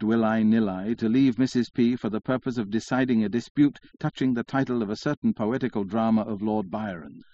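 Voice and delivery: plain reading voice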